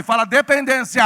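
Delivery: high, very loud shout; shouted prompt. Shown as a demonstration. Preacher urging congregation to shout back, shouted prompt. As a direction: shout it to get the crowd shouting. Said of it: "Dá um grito aí".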